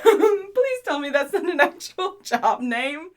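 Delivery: talking through laughter; laughing. Acted. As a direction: laugh while teasing. Laughs at title.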